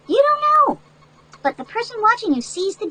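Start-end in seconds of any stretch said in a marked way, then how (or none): 0.1-0.8 much higher, stressed warning; high emphatic warning. Real, not acted. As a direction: jump up high to make them listen. Warning children of danger.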